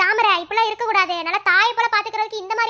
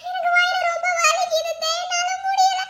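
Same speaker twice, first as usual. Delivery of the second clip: higher, slow tearful pleading; crying in pain; 8 semitones higher, slower than usual. Acted. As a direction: whimper the complaint to your aunt, drawn out. Pregnant wife begging, stomach pain. (அத்தையிடம் வயிற்று வலியால் அழுது கெஞ்சுதல்)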